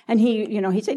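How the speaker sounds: normal talking voice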